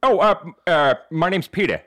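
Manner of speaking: hesitant cartoon dad impression; Peter Griffin. Uninvited guest introducing himself to host, cartoon impression. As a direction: fumble the introduction in a goofy cartoon voice. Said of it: "in Peter Griffin accent".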